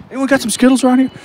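high-pitched call to the room. Real, not acted. Guest asking the room for candy to show his trick, calling out high and quick.

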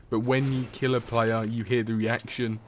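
ordinary delivery

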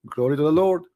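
ordinary delivery

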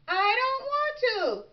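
put-on high-pitched childish whine; whining. A narrator's reading. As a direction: whine it like a reluctant little frog. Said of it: "Froggy whined".

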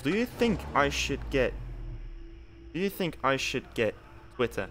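normal talking voice

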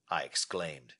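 ordinary delivery

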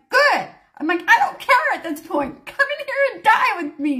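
high, emphatic mock outburst; retold frantic retort. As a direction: act out panicked indifference to everyone else. Acted panicked retort to husband.

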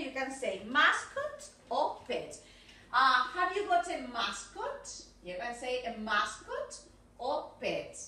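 slow, measured "mascot" and "pet" for learners; pronunciation model. Shown as a demonstration. Teacher repeats the options for students, slow model pronunciation of "mascot" and "pet". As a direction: spell it out by ear, "mascot" drawn out slowly. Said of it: "ojo con la pronunciación".

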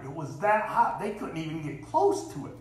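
ordinary talk

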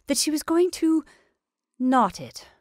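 high, loud, flustered answer; flurried. A narrator's reading. Sheriff's wife hides what she found from the attorney, flurried. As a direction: go up high, then hesitate before the end. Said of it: "in a flurried voice".

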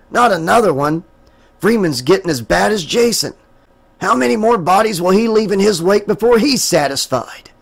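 lightly voiced weary sheriff; a hint of a tired lawman thinking; sheriff's thoughts. A narrator's reading. The sheriff's private thoughts, lightly voiced.

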